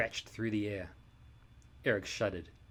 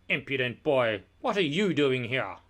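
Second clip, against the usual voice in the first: loud, scornful scolding of an intruder; villainous lord scolding a page boy; angry lord; 3 semitones higher, 7 dB louder than usual. Acted. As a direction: bark at the impudent boy. Lord Droon confronts the boy.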